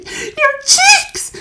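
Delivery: high, loud horrified scream. A narrator's reading. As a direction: cry out at the sight. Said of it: "screamed Missus Beauregard".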